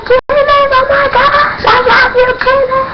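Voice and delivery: very high squealed shrieks; stoked fan screaming. Retold or imitated. Fan at the show as Kenneth comes out, wildly excited. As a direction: squeal the name like a wild fangirl. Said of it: "I am stoked, I am wild out".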